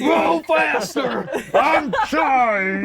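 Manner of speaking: high, laughing panicked shout; laughing while begging for speed; frantic yelling. Acted. Frantic rowing chase scene.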